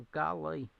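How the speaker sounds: usual speaking voice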